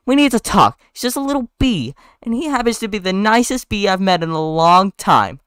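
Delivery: high-pitched woman's voice, insistent; acted female character, pleading high; Vanessa, earnest scolding. Vanessa scolds Ken, defending Barry.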